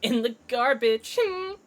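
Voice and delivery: high, put-on sassy witness voice; high teasing character voice with little hums; high-pitched Miss May. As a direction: taunt the lawyer in a high, put-on voice.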